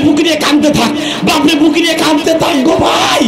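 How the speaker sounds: high, urgent preaching that builds to shouts; impassioned sermon at full force; fiery preaching. Real, not acted. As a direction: drive the crowd to run and weep.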